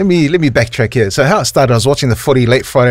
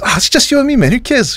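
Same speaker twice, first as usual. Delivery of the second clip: high-pitched imitation of a carefree friend; dismissive mate; 12 semitones higher than usual. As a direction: shrug it off in a raised voice. Retold friend dismissing his worry.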